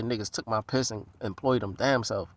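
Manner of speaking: usual speaking voice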